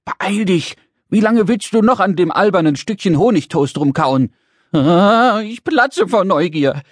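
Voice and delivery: high, impatient little creature's voice; pestering little man. Acted. Little man hurries Brix through breakfast, pestering impatiently. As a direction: nag her urgently, bursting with curiosity. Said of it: "drängelte der kleine rosa Mann ohne Pause".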